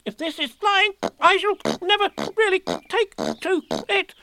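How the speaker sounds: high, squeaky, bumped about; jolted words of a tiny pig; jerky Piglet voice. A narrator's reading. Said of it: "he thought jerkily to himself".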